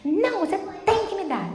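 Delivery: put-on high-pitched demanding child, no real shout; mock toddler demand; imitated yelling little girl. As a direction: mimic a tantruming child at normal volume. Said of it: "mas gritava com a mãe".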